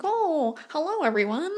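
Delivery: high, mock-prim hello; polite new-job greeting. New coworker greeting the office, high mock politeness. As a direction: play the guarded newcomer lightly. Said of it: "and you're like".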